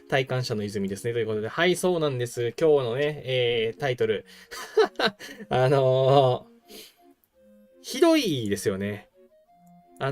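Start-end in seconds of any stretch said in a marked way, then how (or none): 4.5-5.4 high laugh. Real, not acted. Laughing at the title.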